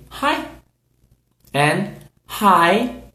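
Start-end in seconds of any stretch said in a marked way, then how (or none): 0.1-0.5 bright high model Hi; demo greeting. Shown as a demonstration. Teacher modelling a greeting for learners, high. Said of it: "we say".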